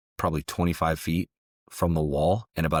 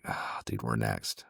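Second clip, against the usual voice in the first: breathy low whisper of dread; hushed dread; 6 semitones lower, 7 dB quieter, slower than usual. Retold or imitated. Soldiers watching Benghazi on TV, a hushed fearful whisper. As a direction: whisper it like bad news is coming.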